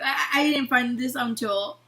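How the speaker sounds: ordinary delivery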